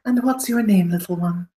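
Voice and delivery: high sugary aristocrat; patronising lilt; condescending noble. Acted. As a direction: talk down sweetly to a small creature. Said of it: "he'll say".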